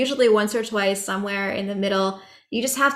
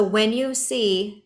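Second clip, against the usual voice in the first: usual speaking voice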